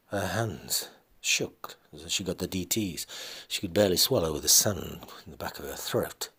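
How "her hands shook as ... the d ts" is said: ordinary delivery, nothing marked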